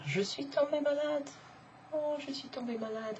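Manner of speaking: high, feeble mock complaint; acted poorly. Teacher acting out falling sick, high poorly complaint. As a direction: pipe it up high, softening as if unwell.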